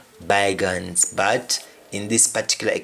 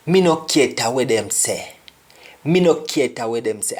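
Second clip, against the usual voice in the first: Jamaican Patois, said twice for learners; demonstrating a Jamaican Patois sentence; Jamaican example; faster than usual. Shown as a demonstration. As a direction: say it in Patois, "cater" as "kieta". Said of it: "You say "Kieta".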